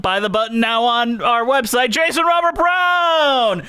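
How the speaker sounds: high, hyped ad pitch stretching out the name; mock fan-club plug. Host plugging a composer's fan club, mock-hype announcement. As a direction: build to a drawn-out, high name call.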